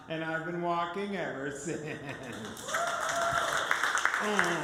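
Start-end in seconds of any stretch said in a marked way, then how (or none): 1.9-4.1 high laugh. Real, not acted. Laughing after walking again.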